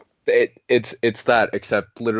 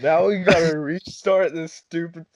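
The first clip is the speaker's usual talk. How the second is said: higher-pitched, hammed-up cursing rant; mock exasperation; 8 semitones higher than usual. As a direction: play at being fed up with restarting. Acting out imagined annoyed restart.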